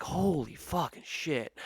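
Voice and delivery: retold slow astonishment; stunned disbelief. Customer seeing the driver's license, slow stunned disbelief. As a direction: draw the words out, mock amazed. Reacting to the license saying Bubba.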